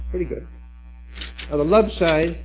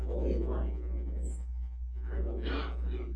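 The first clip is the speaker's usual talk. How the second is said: distant murmured reading; 2 semitones lower, 10 dB quieter than usual. Real, not acted. Love side reading far off.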